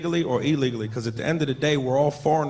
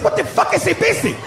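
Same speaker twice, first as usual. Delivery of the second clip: baffled yell; 13 semitones higher than usual. Retold or imitated. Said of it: "I said".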